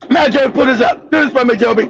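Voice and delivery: ordinary talk, nothing marked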